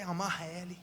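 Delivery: ordinary delivery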